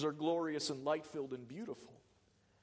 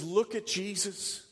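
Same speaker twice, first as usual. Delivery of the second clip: a touch louder preaching as God the Father; the Father's voice; 6 semitones higher, 7 dB louder than usual. Acted. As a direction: lift it up, as God promising care. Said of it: "The Father's voice says".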